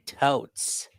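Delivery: clipped mock valley-girl Totes; light California accent on Totes. Shown as a demonstration. Streamer shows chat her weak California accent. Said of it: "I don't really have a California accent".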